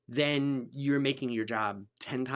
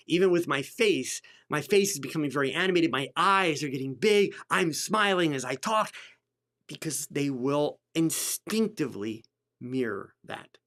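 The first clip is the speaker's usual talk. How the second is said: ordinary talk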